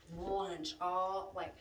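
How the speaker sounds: ordinary delivery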